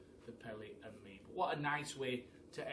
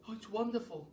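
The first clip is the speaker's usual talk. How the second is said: delighted cry; 8 semitones higher, faster than usual. Acted. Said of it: "cried the giraffe".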